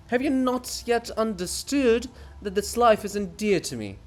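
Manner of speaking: a hint of the pompous writer; lightly voiced weary poet. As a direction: declaim your own sacrifice. Bulgakov's grand speech.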